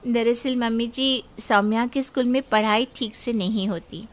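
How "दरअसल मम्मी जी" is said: usual speaking voice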